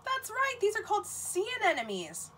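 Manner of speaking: high, delighted answer; excited reveal. Acted. Teacher revealing the answer to children, delighted. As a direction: announce it with delight.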